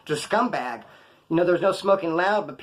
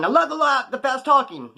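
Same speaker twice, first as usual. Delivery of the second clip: much higher, louder, gushing fan voice; 10 semitones higher, 4 dB louder than usual. Retold or imitated. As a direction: squeal like a delighted viewer. Imitating an adoring viewer.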